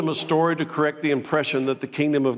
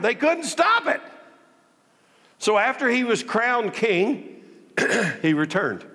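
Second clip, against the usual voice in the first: ordinary talk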